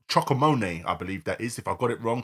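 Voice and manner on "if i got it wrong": ordinary talk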